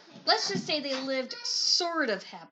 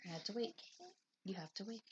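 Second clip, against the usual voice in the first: quiet aside; 4 semitones lower, 14 dB quieter, faster than usual. Real, not acted. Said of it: "aside to listeners".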